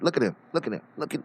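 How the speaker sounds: usual speaking voice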